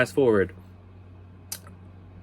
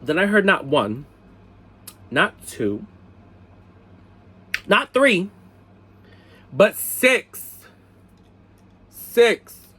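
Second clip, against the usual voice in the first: loud count building to higher outrage; appalled disbelief; 8 semitones higher, 5 dB louder, slower than usual. Retold or imitated. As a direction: punch out each number, scandalized. Recounts crushing news, count building.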